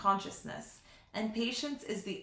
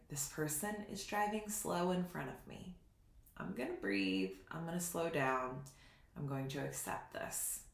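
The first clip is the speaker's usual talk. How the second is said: quiet; 4 semitones lower, 7 dB quieter than usual. Retold or imitated. Calming self-talk in traffic.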